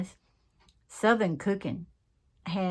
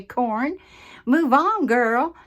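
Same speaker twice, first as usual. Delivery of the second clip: high, loud sassy dismissal; mock viewer sass; 5 semitones higher, 8 dB louder, slower than usual. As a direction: brush her off with attitude. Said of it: "if you all say".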